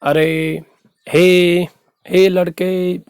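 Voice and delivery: अरे, हे, हे लड़के with each final ए held long; sustained level vowels on अरे, हे, हे लड़के; drawn-out calling. Shown as a demonstration. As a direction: stretch the last vowel of अरे, हे and लड़के, as if hailing someone. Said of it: "दूर से किसी को पुकारने अर्थ में".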